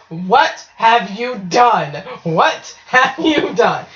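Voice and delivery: slow, word-by-word mock scolding; appalled scolding. Grandma confronting the kids over the wound, appalled. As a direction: draw out each word in imitated horror.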